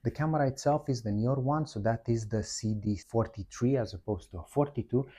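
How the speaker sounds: ordinary delivery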